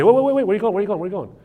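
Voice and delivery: high, loud mock pleading; frantic calling back. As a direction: act out chasing after someone leaving. Acts out calling someone back.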